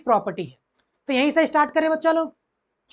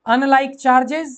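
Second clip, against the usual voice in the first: words stretched long and a bit louder; slow dictation; 5 dB louder, much slower than usual. Shown as a demonstration. Teacher to class, dictating the rule slowly. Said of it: "teacher dictating rule slowly".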